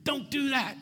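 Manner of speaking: high, exasperated yell at a movie; shouting at the screen. Pretend. Preacher yelling at a movie screen, high and exasperated. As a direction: scream warnings at a dumb movie character. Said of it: "I'd be shouting at the screen".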